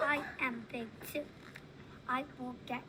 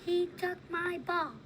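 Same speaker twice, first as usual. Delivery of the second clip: mild loud reading; 6 semitones higher, 6 dB louder than usual. Acted. Child reads the big words.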